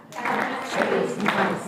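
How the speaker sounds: "Cat" and "mice" very loud and drawn out; slow, forceful beats on "Cat" and "mice"; stressed content words. Shown as a demonstration. Teacher repeats clapping drill for class, stressed content words. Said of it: "we clap on the stress words".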